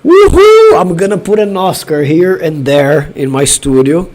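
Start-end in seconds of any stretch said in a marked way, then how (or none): 0.0-0.8 high, loud whoop of joy; elated whoop. Real, not acted. Fan celebrating Brazil's Oscar, whooping. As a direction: let out a real victory whoop. Cheering a live Oscar win.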